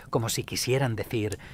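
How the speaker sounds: plain reading voice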